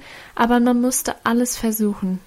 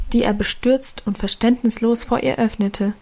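ordinary delivery

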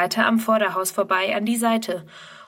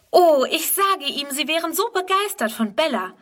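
jubilant; 7 semitones higher than usual. Acted. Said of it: "jubelt Friedrun".

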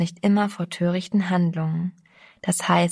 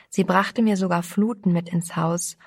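ordinary delivery, nothing marked

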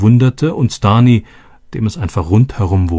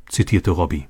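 usual speaking voice

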